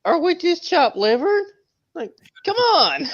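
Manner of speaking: very high, mocking whine; squeaky mock whine. Mocking a friend who felt left out, in a high whine. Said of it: "He's like".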